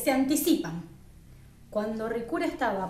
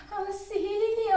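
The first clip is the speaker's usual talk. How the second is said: cry for help; 8 semitones higher, much slower than usual. Acted. Shadow calling out while splashing in water, crying for help. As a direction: stretch out a high-pitched call for help. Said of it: "con mucho chapoteo y gritos".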